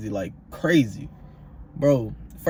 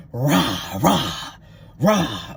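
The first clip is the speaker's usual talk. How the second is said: rough mock sleep yell; imitated sleep yelling; much slower than usual. Roommate yelling nonsense in his sleep, mock yelling. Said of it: "screaming in his sleep, yelling".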